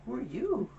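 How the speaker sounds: high, swooping mock bewilderment; baffled reaction. Reacting to the sudden voice change.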